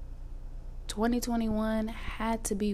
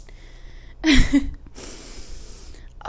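loud laugh; 7 semitones higher, 8 dB louder than usual. Real, not acted.

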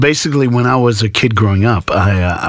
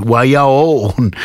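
drawn-out, higher mock call; mock yell; 4 semitones higher, much slower than usual. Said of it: "I'm just yelling to the front seat".

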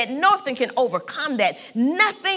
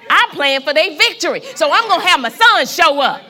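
loud, building sermon; full-force church preaching; 3 semitones higher, 5 dB louder than usual. Real, not acted. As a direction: preach with rising fervor.

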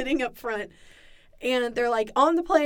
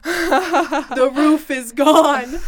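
slow, loud, deadpan pilot announcement; dramatic pilot voice; 10 dB louder, much slower than usual. Retold or imitated. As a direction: drag each word out like a grave captain. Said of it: "And he goes".

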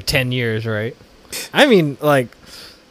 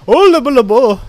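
mocking singsong; 11 semitones higher, 8 dB louder than usual. Mocking imagined reaction.

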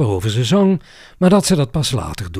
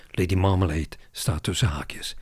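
ordinary delivery

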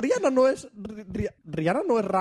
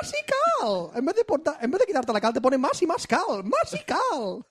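mock radio ad; 4 semitones higher than usual.

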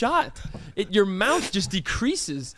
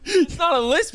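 laughing; 7 semitones higher, 4 dB louder than usual. Real, not acted. Laughing at the lisp.

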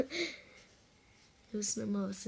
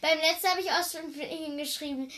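repeated louder so it's heard; 5 semitones higher, 5 dB louder than usual. Shown as a demonstration. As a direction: speak up, louder. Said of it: "Du musst lauter reden".

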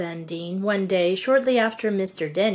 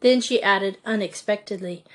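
usual speaking voice

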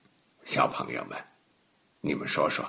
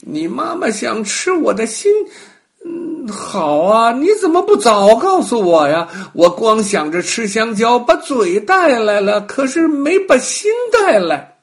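crafty monkey; 8 semitones higher, 7 dB louder than usual. Acted. Monkey tricks the crocodile.

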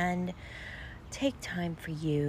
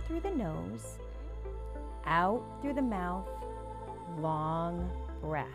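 breathing coach; 3 dB quieter than usual. Acted. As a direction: guide the breaths calmly and softly. Leads guided breathing.

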